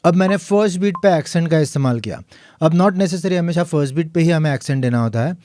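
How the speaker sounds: ordinary talk, nothing marked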